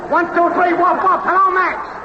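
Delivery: high-pitched, singsong mic-test chant; woofing mic-test yell. Acted. Technician testing if the show is on air, high woofing yell. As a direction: chant it high, evenly, like barked test words. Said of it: "woof woofing and yelling".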